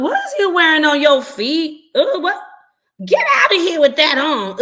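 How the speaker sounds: loud, high mocking jeer at the uncle; mocking ridicule. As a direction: sister mocking her brother's shoes in front of the kids, loud jeering. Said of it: "ridicule and laugh at him".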